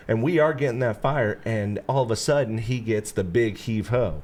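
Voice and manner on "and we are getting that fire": ordinary delivery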